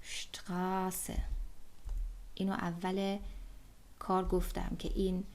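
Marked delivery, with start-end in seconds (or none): 0.0-1.2 شتراسه soft and slow, starting on sh; شتراسه drawn out, sh with no vowel first; German pronunciation. Shown as a demonstration. Teacher repeats the German word for street, softly.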